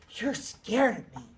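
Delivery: taunting. Acted. Peter taunting during beating.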